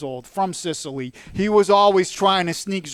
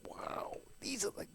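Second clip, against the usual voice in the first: much softer, breathy whispered wonder; hushed awe; 14 dB quieter than usual. Retold or imitated. Recalling tasting carrots at dinner, hushed whisper. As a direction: whisper it like a secret treat. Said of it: "I was like".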